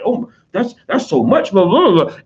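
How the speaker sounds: high-pitched aghast fussing; mock horror. Relatives hearing about an eight hundred dollar expense, mock horror. Voicing relatives horrified at spending.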